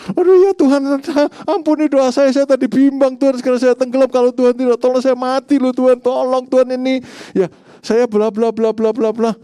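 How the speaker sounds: loud, high, panicked mock prayer; mock rambling plea. Preacher parodies sinking Petrus begging Tuhan at length, mock panic. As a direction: babble a desperate plea, high and loud, into blah-blah. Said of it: "kan enggak, dia cuma teriak".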